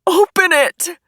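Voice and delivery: high, loud shouted order; urgent full-voiced shout. A narrator's reading. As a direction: yell the command frantically. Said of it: "Chris shouted at me".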